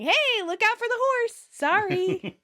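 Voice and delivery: high, excited warning; acted calling out. A narrator's reading. Rachel flailing past the horse, excitedly calling out.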